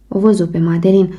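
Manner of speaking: ordinary delivery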